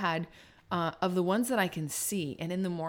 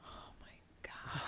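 breathless whispered dismay; whispering; 19 dB quieter, slower than usual. Real, not acted. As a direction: whisper it in despair. Hard choice in game.

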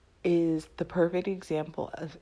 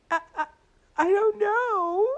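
imitated weepy helplessness; tearful stammer; 6 dB louder than usual. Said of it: "now you're crying".

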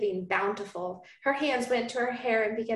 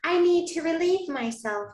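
high, bright Southern belle lilt; lightly Southern heroine asking sweetly; Southern accent; 8 semitones higher, 3 dB louder than usual. Acted. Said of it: "I don't know why I'm going Southern with her".

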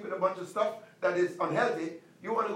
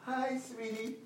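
put-on cooing at baby; 9 dB quieter, slower than usual. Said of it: "coming to go".

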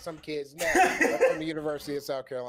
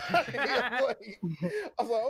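high laughing words, slowed; 9 semitones higher, 4 dB louder than usual. Real, not acted. Laughing while telling story.

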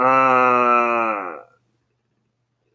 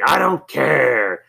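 slow, higher-pitched cranky imitation; cranky retort; 6 semitones higher, 4 dB louder, much slower than usual. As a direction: drag it out like a stubborn grump. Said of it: "cranky. Like, whenever he".

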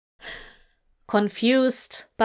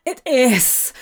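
louder cry of pleasure; cried out; 3 semitones higher, 5 dB louder than usual. A narrator's reading. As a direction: let it burst out of him. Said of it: "Crowley cried".

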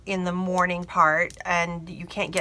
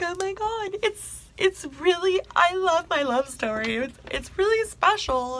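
gushing bride; 10 semitones higher than usual. Retold or imitated. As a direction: coo about it, pitched well up. Imitates the bride gushing about story.

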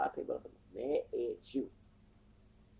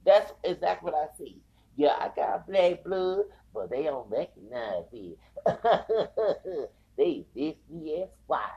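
louder, higher, slowed, broken by laughs; amused, raised voice between cackles; loud laughing rant; 4 semitones higher, 9 dB louder than usual. Real, not acted. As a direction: laugh through the complaint.

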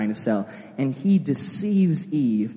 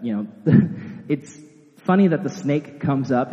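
usual speaking voice

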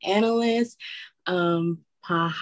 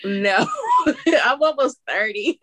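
laughing; 6 semitones higher, 3 dB louder than usual. Real, not acted. Laughing at being called young.

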